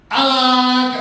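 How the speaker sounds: high, loud reveal shout; triumphant ta-da. Pretend. Teacher revealing the stick trick to children, triumphant. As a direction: announce the trick like a magician.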